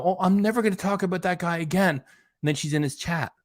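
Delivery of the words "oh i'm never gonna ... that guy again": higher, put-on voice mocking her promise. Vlogger mimicking Chantel's broken promise, mock voice. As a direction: sneer it like a fake vow. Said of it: "like, you know".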